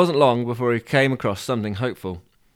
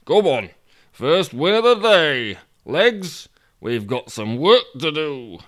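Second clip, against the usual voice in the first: slow, raised character voice urging on; rally the troops, drawing words out; rallying Toby; 4 semitones higher, 4 dB louder, slower than usual. Acted. Toby rallying his legs for work, bossy.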